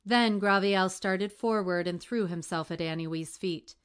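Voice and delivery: ordinary delivery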